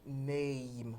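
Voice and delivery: NAME with a long, drawn-out A; long vowel demo. Shown as a demonstration. Teacher models the word in phonetics, long-vowel NAME. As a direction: stretch the vowel in Name slowly. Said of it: "that's a long sound".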